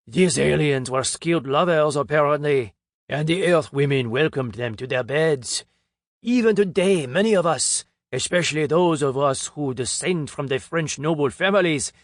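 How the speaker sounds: put-on a pompous Frenchman revealing alien bloodlines, French accent. A narrator's reading. French speaker telling the conference crowd, heavy French accent. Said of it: "In his French accent".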